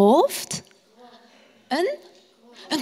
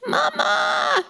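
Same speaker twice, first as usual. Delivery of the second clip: put-on a small child calling out much higher; child calling mama. Scared little Salomo calling his mother at night, high calling voice. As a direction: call for mama like a scared little boy in the dark. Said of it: "roept hij".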